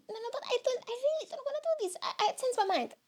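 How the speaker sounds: high-pitched, hurried protest; reluctant whiny self. Acted. Reluctant podcaster begging the inner voice to skip it, high protest. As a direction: play the panicky side that wants out.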